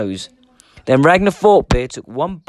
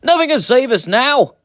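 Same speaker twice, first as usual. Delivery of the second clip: despairing cry; 12 semitones higher, 5 dB louder than usual. A narrator's reading. Men despairing before the dragon.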